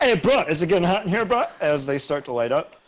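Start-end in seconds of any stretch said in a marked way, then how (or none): 0.0-1.4 fast, loud dudebro question; bro voice. Acted. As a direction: rattle it off like a panicked bro. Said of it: "they're like".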